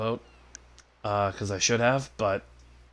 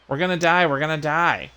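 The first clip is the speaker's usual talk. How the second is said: high, louder mock panic; mock whining baby; 6 semitones higher, 5 dB louder than usual. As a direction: mimic someone whining that it's all over. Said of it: "whining like a little big baby".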